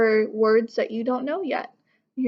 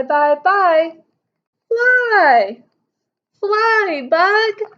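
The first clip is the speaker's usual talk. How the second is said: excited reading; 7 semitones higher, 6 dB louder, much slower than usual. Acted. Reading repeating words aloud excitedly.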